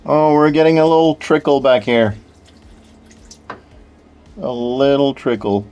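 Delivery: ordinary talk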